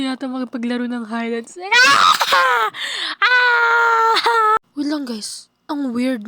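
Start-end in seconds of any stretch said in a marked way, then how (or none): 1.7-2.7 high-pitched scream of fright; frightened scream. Acted. Frightened scream in horror game. 3.2-4.6 high, drawn-out scream; long scared shriek; frightened scream. Acted. Frightened scream in horror game.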